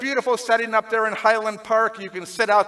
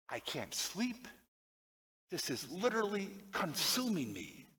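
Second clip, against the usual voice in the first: low, much softer, heavy with grief; grieving woman; 6 semitones lower, 6 dB quieter than usual. Retold or imitated. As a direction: let the words sink into quiet despair. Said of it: "she told me the following".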